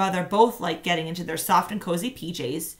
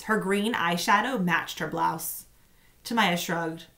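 plain reading voice

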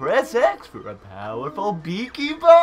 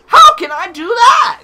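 high, loud cartoon yell; shouty cartoon character; 11 semitones higher, 11 dB louder than usual. Imitating how the game character has to talk, loud high shout. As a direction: pitch it way up and shout it. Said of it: "He's have to talk like that".

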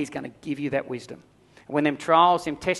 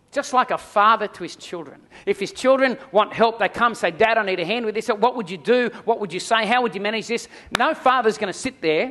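raised, higher preaching voice pressing the point; impassioned preaching; 5 semitones higher, 4 dB louder than usual. Acted. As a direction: preach it out to the congregation with fervour. Preacher builds to full force.

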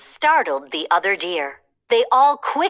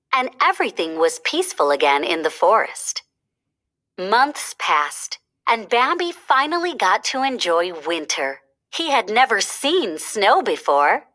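ordinary delivery, nothing marked

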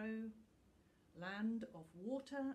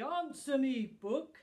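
furious dragon roar, much louder and higher; thundering; 6 semitones higher, 10 dB louder than usual. A narrator's reading. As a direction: thunder it at the book. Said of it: "he thundered".

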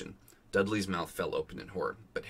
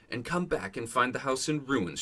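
higher-pitched, indignant snarl; angry, raised-pitch objection; 6 semitones higher, 4 dB louder than usual. A narrator's reading. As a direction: snarl it as an outraged aunt. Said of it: "she snarled".

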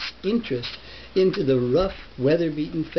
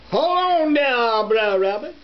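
sly fox hollering a greeting; Brer Fox calling; 7 semitones higher, 4 dB louder than usual. Acted. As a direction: hail him from down the road. Brer Fox calls out to Rabbit.

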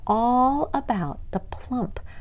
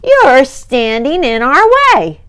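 high, loud ant troop shouting; rowdy yell; 6 semitones higher, 11 dB louder than usual. Acted. Rowdy ants shouting at Snail to move. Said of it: "yelled a troop of rowdy ants".

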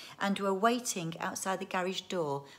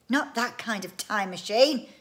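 raised, louder insistence; exasperated correction; 4 semitones higher, 8 dB louder than usual. Acted. Inventor corrects wife's clock guess, raised and exasperated. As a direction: correct her with impatience.

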